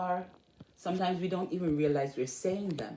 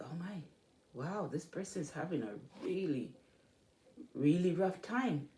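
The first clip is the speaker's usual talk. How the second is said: much softer stunned aside to yourself; muttered aside; 3 semitones lower, 8 dB quieter than usual. Acted. Listener reacting privately to a neighbour's woes, hushed. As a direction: murmur it under your breath, a touch dismayed. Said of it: "you're like".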